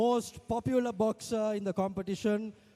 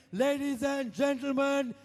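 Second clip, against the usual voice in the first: announcer hype; 3 semitones higher than usual. Real, not acted. Hyping crowd for runner-up.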